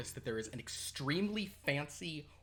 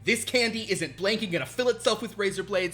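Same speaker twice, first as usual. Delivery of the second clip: hard-sell pep rant; 6 semitones higher, 6 dB louder than usual. Acted. Boss watch chanting a sales motto at workers, loud and high. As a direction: drill the motto into them.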